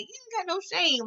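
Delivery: lightly voiced disapproving mother; mock scolding mom. Mother scolding her son over the business card, mock scolding. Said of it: "his mom was like".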